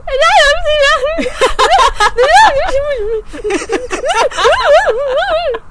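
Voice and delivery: loud, high wordless bawling; hammed-up incoherent crying wail; mock choking sobs. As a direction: imitate blubbering too hard to talk. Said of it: "choking crying".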